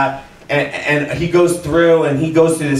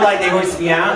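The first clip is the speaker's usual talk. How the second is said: ordinary delivery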